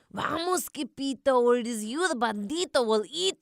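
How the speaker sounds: high urgent warning; panicked cry. A narrator's reading. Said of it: "they cried".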